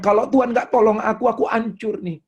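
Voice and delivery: high, loud acted desperation; desperate testimony. A saved person admits need of God, acted high and desperate. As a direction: raise the voice as if pleading helplessness.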